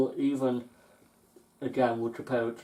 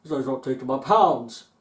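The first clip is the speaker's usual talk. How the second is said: retold complaint, louder; 8 dB louder than usual. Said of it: "he started shouting".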